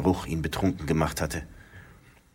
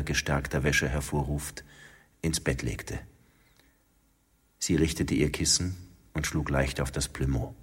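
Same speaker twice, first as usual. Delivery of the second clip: plain reading voice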